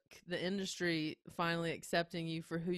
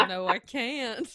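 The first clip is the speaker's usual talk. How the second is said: loud, slow Southern "I can't"; Southern grandma; 4 semitones higher, 6 dB louder, slower than usual. Retold or imitated. Voice actor failing a non-Southern audition, loud Southern drawl. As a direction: drawl it out higher and louder in Southern. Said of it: "do a not Southern grandma".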